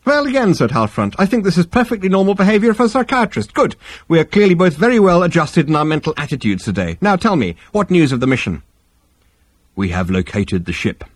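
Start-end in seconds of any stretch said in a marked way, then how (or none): 0.0-0.5 much higher, bright therapist voice; smooth psychiatrist. Acted. Halfront voice. 1.2-8.6 much higher, bright therapist voice; smooth psychiatrist. Acted. Halfront voice. 9.7-11.0 lower, heavy alien captain; low Vogon voice. Acted. Vogon reply.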